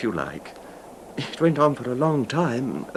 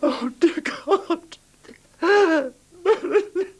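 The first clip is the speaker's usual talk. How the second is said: slow, much higher, half laughing half crying; overcome with relief; 12 semitones higher, 3 dB louder, much slower than usual. Acted. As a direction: let relief break the voice. Sick husband sees wife, overcome.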